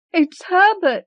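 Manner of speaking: high frantic scream of hope. Acted. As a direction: scream his name wildly. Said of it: "she screamed".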